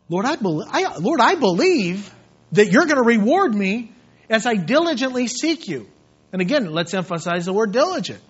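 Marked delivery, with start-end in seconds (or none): 0.1-5.9 loud, high, impassioned appeal; fervent prayer. Acted. Preacher telling the Lord he believes, fervent prayer. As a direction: pray it out forcefully from the pulpit.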